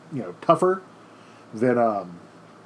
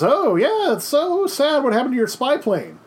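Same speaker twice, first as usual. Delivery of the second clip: much higher, syrupy fake pity; mock Khrushchev sympathy; 10 semitones higher than usual. Khrushchev taunting Eisenhower over the spy plane, much higher mock pity. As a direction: feign sorrow to needle him. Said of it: "Khrushchev says".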